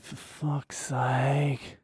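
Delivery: long drawn-out despairing whisper; exasperated whisper. Retold or imitated. Pupil reacting to teacher's bragging story, exasperated whisper. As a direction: whisper it very slowly, at the end of patience.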